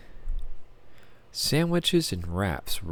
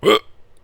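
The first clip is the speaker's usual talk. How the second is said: sudden sharp hiccup gasp; a loud involuntary hiccup; 13 semitones higher, 8 dB louder than usual. Real, not acted. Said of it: "why am I hiccuping?".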